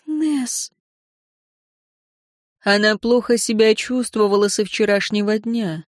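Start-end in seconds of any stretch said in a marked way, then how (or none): 0.1-0.7 shocked whisper. A narrator's reading. Victoria's shocked realization.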